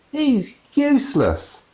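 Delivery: high, slow sneering voice; slow contemptuous sniff; scornful old woman. A narrator's reading. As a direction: drag it out with disdain. Same woman scoffing.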